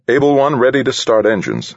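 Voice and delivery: high, loud radio call. Acted. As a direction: call it crisply into the mic. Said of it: "she said into the pin mic".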